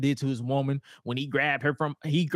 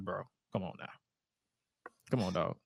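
much softer grumbling; muttered complaint; 6 semitones lower, 7 dB quieter than usual. Retold or imitated. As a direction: grumble it under your breath.

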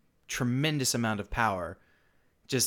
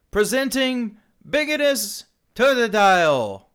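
herald announcing; 9 semitones higher, 9 dB louder than usual. Acted. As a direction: announce it like a royal crier. Herald fish reads scroll.

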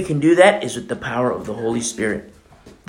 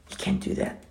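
whispered warning; 11 dB quieter than usual. Real, not acted. Sharp warning.

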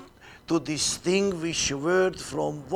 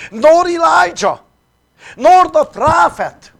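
much higher, louder insistent denial; emphatic denial; 11 semitones higher, 11 dB louder than usual. Acted. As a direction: push it up high, insisting: not Elijah. John's denial, pitched high.

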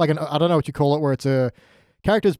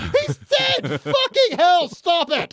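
far higher, shrill-pitched mock exasperation; exasperated sister; much slower than usual. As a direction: cry out fed up with him dying. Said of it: "his sister was just like".

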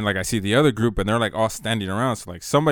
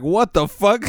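higher, louder shocked yell; outraged yell at friends' answer; retold shout; 10 semitones higher, 7 dB louder, slower than usual. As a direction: blurt it out loud. Retold outburst again.

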